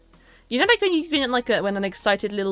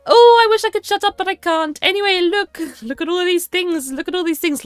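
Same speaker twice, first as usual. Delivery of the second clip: much higher, louder, hammed-up silly voice; mock silly voice; 11 semitones higher, 9 dB louder than usual. Reading her old Instagram post to the stream in a high mock voice. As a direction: squeal it like a cringey old post. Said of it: "a post from three years ago being like".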